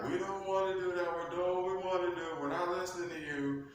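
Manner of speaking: high, fast mocking defiance; the angels brush him off; defiant angels. Angels refusing Lucifer's orders, high and fast.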